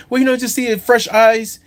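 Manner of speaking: mock the client's easygoing suggestion.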